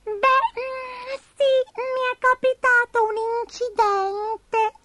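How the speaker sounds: very high, hesitant and slow; sheepish tiny creature confessing; squeaky, drawn-out awkward admission; Abigail the little spider. A narrator's reading. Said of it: "cominciò Abigail".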